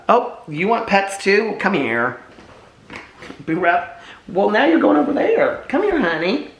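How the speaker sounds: high cooing pet voice, stretched words; baby talk to dogs. Acted. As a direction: coo lovingly at the dogs. Owner cooing to her dogs.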